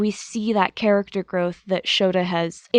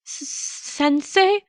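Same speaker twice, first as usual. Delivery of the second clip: stunned, stammering; 6 semitones higher, much slower than usual. Acted. Ghost boy sees his teacher.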